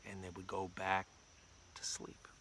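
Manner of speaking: low and soft, fading to a whisper; hushed narration. A narrator's reading. Storyteller to kids as town goes back to bed, hushed. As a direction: drop away as if lulling them.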